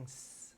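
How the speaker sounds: S hissed, tongue behind teeth; a long snake hiss on S; drawn-out s like a snake. Shown as a demonstration. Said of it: "sounds like a snake hissing".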